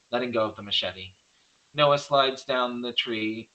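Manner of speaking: usual speaking voice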